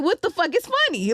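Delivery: high, loud bewildered outcry; mock exasperated confusion. Baffled by own laughing on shrooms, mock exasperation.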